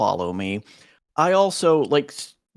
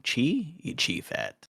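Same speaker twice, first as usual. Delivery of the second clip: ordinary talk, nothing marked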